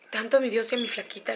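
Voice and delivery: ordinary talk, nothing marked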